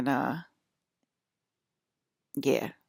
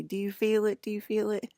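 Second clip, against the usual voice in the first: raised-pitch quote, mildly acted; imitated friend's question; 5 semitones higher, 3 dB louder than usual. Friend saying it to me back then, imitated higher question.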